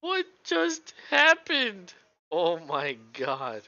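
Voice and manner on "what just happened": stunned slow disbelief. Real, not acted. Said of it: "repeats the question".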